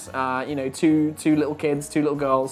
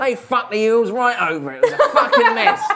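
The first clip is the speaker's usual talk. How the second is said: laughing through the rant; 8 semitones higher, 4 dB louder than usual. Acted.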